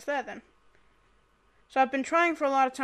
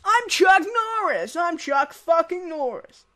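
loud, raised boastful yell; big playful bellow; mock heroic shout; 4 semitones higher, 8 dB louder than usual. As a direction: shout it like an action hero, hammed-up. Boasting as Chuck Norris in game.

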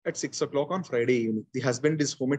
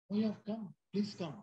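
softer, higher put-on welcome; feigned welcome; 5 semitones higher, 12 dB quieter than usual. Shown as a demonstration. Speaker shows trainees how to greet an unwelcome visitor, feigned welcome. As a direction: quietly greet an unwanted guest, pitched up. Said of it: "why the voice is moving up and down".

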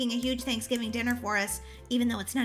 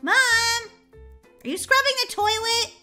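high, drawn-out call; 7 semitones higher, slower than usual. Acted. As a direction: call through the house for mom. Child calls for mom upstairs.

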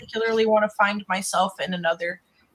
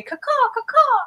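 bird call; 3 dB louder than usual. Retold or imitated. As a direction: caw like a crow signaling. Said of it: "you just say".

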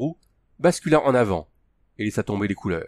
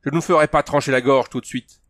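louder, venomous threat; hateful; 4 semitones higher, 5 dB louder than usual. A narrator's reading. Baptiste threatens two enemies' lives, hateful. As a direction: threaten them with hatred. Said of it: "les yeux luisants de haine".

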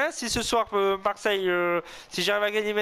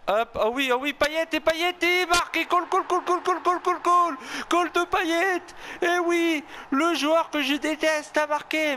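excited goal call; 9 semitones higher than usual. Acted. Solo streamer commentating his FIFA match, roaring a goal call. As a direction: sell the goal like a stadium commentator.

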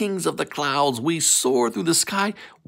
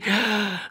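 mock gasp; 8 semitones higher, 3 dB quieter than usual. Surprise before snakes reveal.

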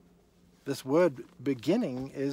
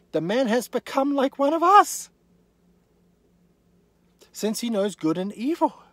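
much higher and louder; booming declaration; 10 semitones higher, 9 dB louder than usual. Acted. Preacher proclaims God's verdict, high and loud. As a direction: proclaim it to the rafters.

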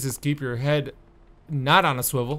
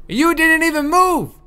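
loud, high, outraged shout; furious yell; 14 semitones higher, 9 dB louder than usual. Real, not acted. Player yelling at the ogre who never moved, in outrage. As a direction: blow up at him for standing still.

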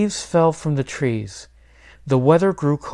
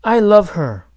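higher, louder, heartfelt protest; crying out; 8 semitones higher, 7 dB louder than usual. A narrator's reading. Boy pleads for his pet turkey, crying out. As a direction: plead it loudly as a boy would. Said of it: "cried Miguel".

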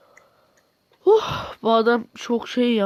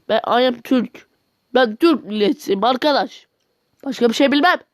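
mock angry rant; 2 semitones higher, 4 dB louder than usual. Ranting at the Korean video, hammed-up angry shouting. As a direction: rant like an offended patriot, half joking. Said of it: "Pardon biraz sinirlendim".

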